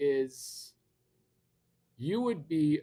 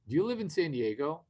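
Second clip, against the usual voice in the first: normal talking voice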